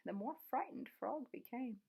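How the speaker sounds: plain reading voice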